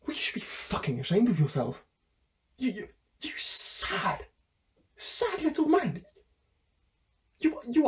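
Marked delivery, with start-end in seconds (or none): 2.6-7.9 slow, high, sneering "sad little man"; contemptuous insult. Acted. Fired worker sneering at his boss Anthony, slow and high. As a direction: spit out every word in disgust.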